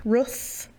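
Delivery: "Roof" with the short vowel of book. Shown as a demonstration. Builder trying out the other pronunciation, "Roof" with a short oo. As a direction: say "Roof" to rhyme with hoof, short oo. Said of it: "Do you say roof or roof?".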